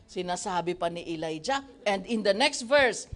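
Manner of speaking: normal talking voice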